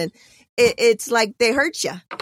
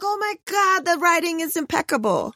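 much higher, gushing "the writing is impeccable"; gushing excitement; 9 semitones higher than usual. Retold or imitated. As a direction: relive the thrill of loving the writing. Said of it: "And that's what I was just like".

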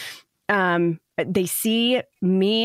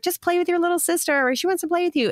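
put-on much higher, sing-song coaxing of a child; imitating mom's coaxing voice; 11 semitones higher, faster than usual. Mom coaxing her oldest to play with her sister, high sing-song voice. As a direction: put on a high, sweet parent voice to nudge her along. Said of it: "be like".

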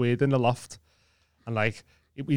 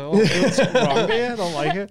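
mock alarm; 6 semitones higher, 5 dB louder than usual. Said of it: "it's got weird flashbacks".

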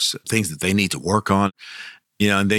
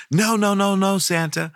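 put-on child protesting; 10 semitones higher than usual. Child refusing the pinch.

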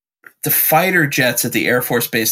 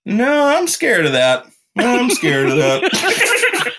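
much higher, slow mock-frightened dad; high, drawn-out pretend fear, laughing; dad impression; 10 semitones higher, slower than usual. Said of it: "he's just sitting back one day in his chair".

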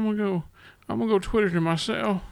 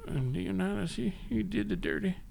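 normal talking voice

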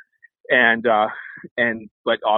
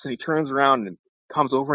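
normal talking voice

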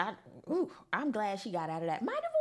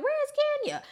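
high, teasing call for Kenya; mock where is she; 4 dB louder, much faster than usual. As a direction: pretend to look around for her, teasing. Mock search for the silent Kenya.

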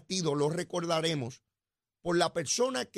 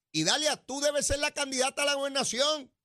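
high-pitched, enthusiastic appeal; fervent urging; 7 semitones higher than usual. Acted. Host telling Idalia she should run, fervent urging. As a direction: urge her on in a raised pitch.

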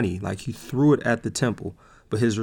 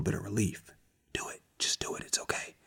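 very soft, coaxing half-whisper; whispering tempter; 11 dB quieter than usual. Acted. Devil urging immediate relief, hushed coaxing. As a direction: tempt them under your breath.

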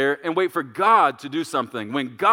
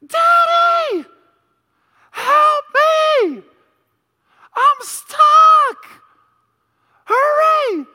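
very high, loud shriek with long held vowels; screaming child in panic; 10 dB louder, much slower than usual. Retold or imitated. Dad acting out young daughter trapped in the kitchen, panicked screaming. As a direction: scream for help at the top of your lungs. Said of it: "screaming at the top of her lungs".

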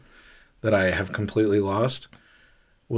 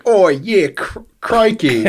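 higher, louder mock British with an Australian slip; bad British accent; 9 semitones higher, 4 dB louder, slower than usual. Shown as a demonstration. Host showing his lost accent skill, joke British accent. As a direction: ham up a terrible British accent. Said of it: "that's my British ac".

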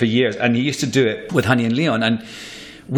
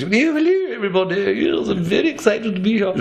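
high, posh-sounding English actress greeting; high-pitched dog voice as an English actress; 12 semitones higher than usual. Retold or imitated. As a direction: play a much higher, theatrical English lady. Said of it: "a sort of an English actress".

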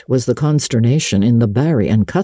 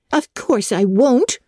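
quick sharp retort, spirited; flashing retort; 11 semitones higher, slower than usual. A narrator's reading. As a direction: snap it back with spirit. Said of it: "flashed Anne".

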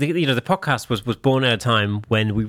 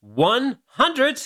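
announcer voice; 12 semitones higher, slower than usual. Acted. Podcast intro, high announcer.

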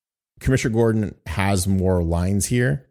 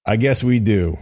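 higher, louder New York attempt; playful mock New York accent; 4 semitones higher, 5 dB louder than usual. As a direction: answer in your best New York accent. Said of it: "That's my best New York accent".

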